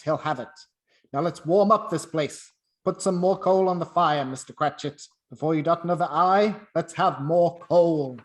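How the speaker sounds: loud, booming with good cheer; a generous boss shouting for joy; jubilant. Acted. Scrooge jubilant to Cratchit.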